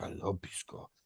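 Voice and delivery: hushed unvoiced confession; whispered. Neighbor whispering his love for his drink. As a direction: breathe it out like a secret.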